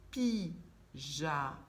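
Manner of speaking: "pyjama" drawn out very slowly; slow repeat. Shown as a demonstration. Teacher explaining rhyme, very slow. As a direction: stretch "pyjama" syllable by syllable. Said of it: "on peut dire que".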